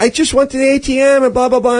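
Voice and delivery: high, quick mock lament; panicky lamenting. Mugged woman repeats her loss to her rescuer, mock panic. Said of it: "She was panicking and kind of lamenting".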